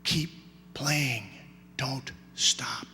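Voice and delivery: soft, low, spaced-out encouragement; whispering in ear. Acted. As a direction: murmur it slowly into the boy's ear. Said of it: "and whispers in his ear".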